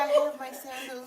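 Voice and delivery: slow, high pretend voice; LaToya. Answering about beach sandals as LaToya, high and slow. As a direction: draw it out high, close to giggling. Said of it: "You be LaToya".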